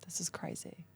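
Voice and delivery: softly whispered This is crazy; retold husband, hushed and amazed; whispered disbelief. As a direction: imitate husband murmuring in amazement. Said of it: "And he's like".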